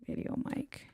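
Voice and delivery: voiceless whisper of the word; whispered repeat. Real, not acted. Editor checks the caption under his breath, whispering. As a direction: breathe it while typing.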